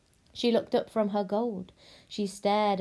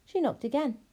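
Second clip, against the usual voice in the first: plain reading voice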